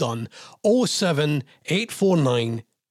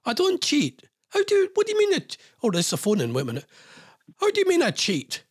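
much higher, squeaky outraged protest; indignant disbelief; 9 semitones higher than usual. Real, not acted. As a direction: protest your innocence in shock. Host indignantly denies cheating.

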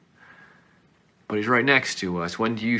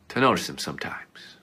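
whisper; 4 semitones lower, 6 dB quieter than usual. Acted. Sermon on God's whisper.

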